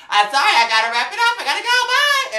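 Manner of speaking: imitating [name]. Vlogger imitates [name] rushing off stage, loud and high. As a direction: play the diva rushing off stage. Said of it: "acting a mess on that stage".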